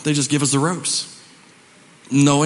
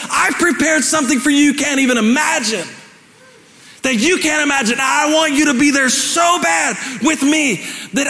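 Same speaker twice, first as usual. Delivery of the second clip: high, loud preacher's shout; fiery preaching; 10 semitones higher, 4 dB louder than usual. Acted. Pastor preaching to the congregation, loud and fervent. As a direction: preach it out with full force.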